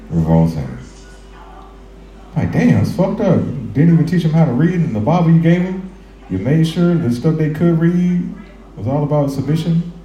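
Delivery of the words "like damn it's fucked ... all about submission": indignant, much higher voice; shrill mock outrage; high-pitched outrage.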